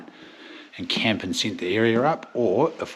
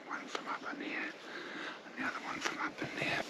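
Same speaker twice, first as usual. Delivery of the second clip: hushed hunter's whisper; 11 dB quieter than usual. Real, not acted. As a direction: whisper so the deer won't hear. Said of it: "hunting near stags, whispering".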